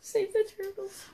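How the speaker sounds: high little squeaky voice; high put-on voice. Contestant repeats the plea in a high put-on voice. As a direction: pitch it way up, playfully.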